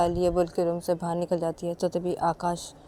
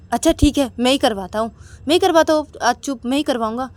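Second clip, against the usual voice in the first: defiant husband; 6 semitones higher, 9 dB louder than usual. Acted. Akash defiant.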